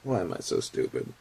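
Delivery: normal talking voice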